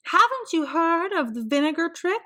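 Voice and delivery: high, prim neighbour voice; a busybody's high voice; nosy neighbour voice. A narrator's reading.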